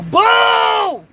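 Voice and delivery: loud booing shout; mock booing. Booing a graduate at the Apollo, loud mock shout. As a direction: boo her off the stage. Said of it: "I would've booed that bitch".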